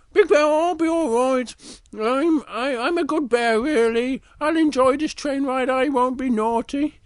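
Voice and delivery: high-pitched little bear voice. Acted. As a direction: promise it in a high, childlike story voice. Little Bear answers Big Bear's warning.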